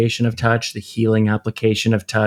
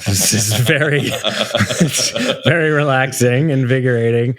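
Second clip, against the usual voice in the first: laughing; 3 semitones higher than usual. Real, not acted. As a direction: chuckle while saying it. Laughing on the massage table.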